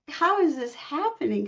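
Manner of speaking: high, astonished question; can't believe it's happening; a high-pitched retold gasp of wonder; amazed disbelief. Amazed at mother's message.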